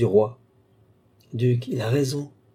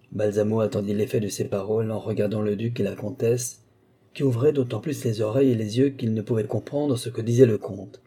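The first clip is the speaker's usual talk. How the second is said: usual speaking voice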